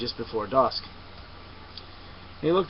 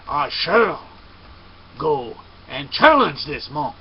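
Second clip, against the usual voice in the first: loud, slow, proud vow of a samurai; slow and loud, like a warrior swearing an oath; proud declaration; 7 dB louder, slower than usual. Acted.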